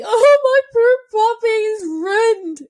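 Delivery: high-pitched, drawn-out mock wail; mock hysterical tears. Dog owner retelling her meltdown over her puppy, melodramatic tearful wailing. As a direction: wail it slowly in exaggerated despair. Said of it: "I burst into hysterical tears".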